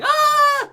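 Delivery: short mock scream, loud and very high; hammed-up cry of panic; panicked scream. Shown as a demonstration. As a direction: burst out with a high, acted shriek. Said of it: "nothing in her voice to go like".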